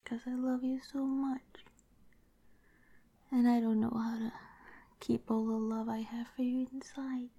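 overwhelmed with love, tearful little laughs; happy tears. Acted.

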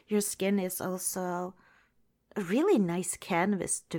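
usual speaking voice

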